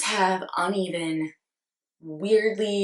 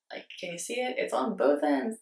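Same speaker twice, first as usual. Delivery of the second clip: ordinary talk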